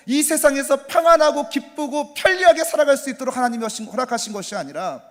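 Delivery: loud, high preaching build; full-force pulpit cry; fervent preaching. Acted. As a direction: preach it with rising fire.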